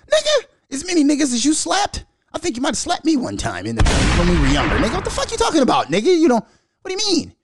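high, fast, indignant comeback; incredulous retort. Retold or imitated. Telling the homeboy off about slapping, high and incredulous. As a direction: scoff back at him in disbelief.

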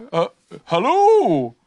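loud, slow creature call; strange animal. A narrator's reading. Strange animal answers Pooh yet another time, slow loud call. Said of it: "said the strange animal".

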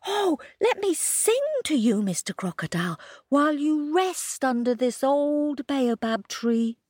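high, coaxing little hare voice; sweet-talking Kojo. A narrator's reading. Kojo coaxing the crocodile to rest, high and sweet. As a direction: lay on the charm, voice lifted.

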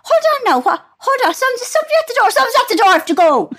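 hammed-up mother inventing a knock at the door; flustered mum. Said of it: "She goes".

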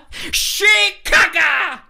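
high shouted cry through laughter; laughing shout. Acted. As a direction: yell it out mid-laugh. Ace Ventura bit, laughing shout.

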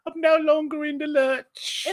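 high-pitched fancy British; hammed-up posh British falsetto; mock posh British accent. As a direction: put on a refined British lady. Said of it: "I'll make it better".

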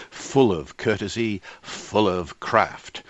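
"Full" and "courtesy", "craft" hit slow; alliteration stressed. Shown as a demonstration. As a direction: lean on the F and C sounds. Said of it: "the F and the C".

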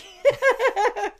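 high-pitched burst of laughter. Real, not acted. Reacting to the watery sausage meat, high laugh.

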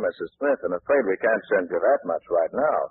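usual speaking voice

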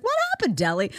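high innocent what happened; baffled. Retold or imitated. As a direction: play dumb and baffled. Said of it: "I'll be like".

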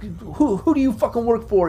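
high, stammered demand; intimidated prisoner. Acted. Game master as prisoner questioning his captors, intimidated. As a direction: question them as a rattled captive. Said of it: "he seems a bit intimidated by that".

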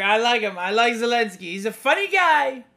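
hammed-up Trump bragging; Trump impression. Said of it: "He's like".

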